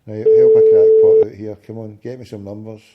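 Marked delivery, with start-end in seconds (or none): none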